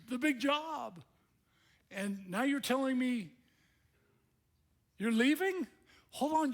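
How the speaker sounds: high, loud, incredulous outburst; shocked disbelief. Acted. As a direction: blurt it out as if betrayed and stunned. Preacher voices Peter's shocked protest.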